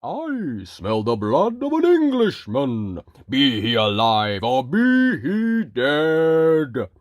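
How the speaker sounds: loud, slow menacing giant voice; booming giant. Acted. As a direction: roar it like a hungry giant. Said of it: "a giant strode in".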